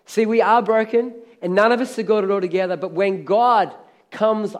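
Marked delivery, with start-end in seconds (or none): none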